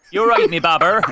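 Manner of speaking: Bristol 'me babber' said loud; a friend prompting the GM's accent, Bristolian. Shown as a demonstration. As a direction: coax him toward Bristol. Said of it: "That's it".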